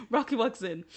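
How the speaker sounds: Rocky walks in said with a giggle; laughing. Real, not acted. Vlogger retelling Rocky's entrance, still laughing. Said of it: "I'm sorry".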